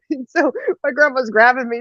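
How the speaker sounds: ordinary delivery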